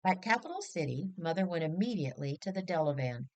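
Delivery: ordinary delivery, nothing marked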